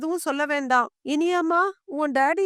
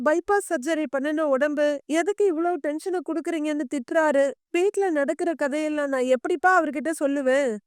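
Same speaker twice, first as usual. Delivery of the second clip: ordinary delivery, nothing marked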